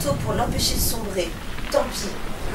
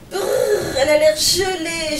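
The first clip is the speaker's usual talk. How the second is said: shivering moan; 5 semitones higher, 4 dB louder, slower than usual. Acted. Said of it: "gémit Bigoudi".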